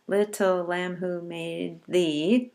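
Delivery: slow, even poem reading with each syllable counted; slow recitation. Shown as a demonstration. Teacher counting syllables for the class, slow and measured. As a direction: draw out made thee. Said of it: "we have kind of a slow "made thee".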